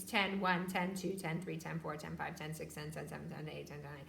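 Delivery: fading to a hush; counting under breath. Shown as a demonstration. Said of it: "Whisper count as you work".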